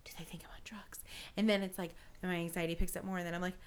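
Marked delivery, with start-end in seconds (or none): 0.0-1.0 whispered inner worry; hushed, mock-paranoid whisper; paranoid thought. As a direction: whisper it under your breath, acting worried.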